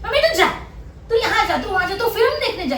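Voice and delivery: very high-pitched mock child; बच्चों की नकल. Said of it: "ये मुझे ऐसे बोलते". (बच्चों की ऊँची आवाज़ में नकल)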